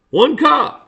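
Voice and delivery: ONE COP punched high and loud; emphatic repetition. Real, not acted. Blaming all the trouble on a single officer, emphatic.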